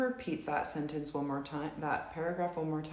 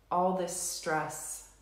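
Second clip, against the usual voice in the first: slow, measured setup phrases with long pauses; tapping script read; slower than usual. Acted. Tapping leader reads the setup statements to the group, slow and measured. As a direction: guide the group through each tapping statement.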